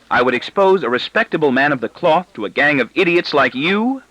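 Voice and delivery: lifted, exultant teasing. A narrator's reading. Wife teasing her family as idiots, exultant. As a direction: ride the high of fooling them. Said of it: "in her rare flight of exultation".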